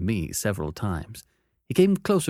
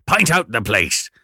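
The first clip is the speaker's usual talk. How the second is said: gruff convict; 7 dB louder than usual. Acted. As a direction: growl the order. Convict demands.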